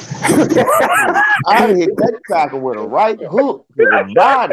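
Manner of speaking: slow, laughing brag; laughing boast. Real, not acted. Boasting fight story, laughing.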